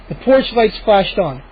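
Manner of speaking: ordinary delivery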